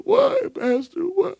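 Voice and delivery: high, mock-crying plea; tearful girl. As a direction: whimper the question. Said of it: "I said".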